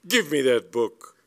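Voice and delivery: loud, imperious order; outraged demand. A narrator's reading. Lady Bassett demanding her stolen book, loud and outraged. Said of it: "she cried".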